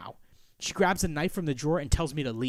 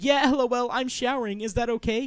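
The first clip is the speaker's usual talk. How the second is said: high, raised-voice awkward reply; calling through door; 7 semitones higher, 6 dB louder than usual. Acted. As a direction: call it out to someone outside. Acts own answer through bathroom door.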